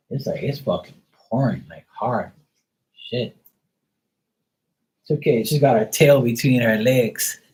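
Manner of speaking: normal talking voice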